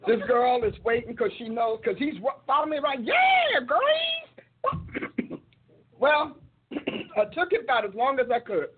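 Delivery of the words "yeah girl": mock flirty man. Mocking a man calling after her on the street, in a high hammed-up voice. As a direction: ham up a guy hollering at a woman. Retelling a man's flirty greeting.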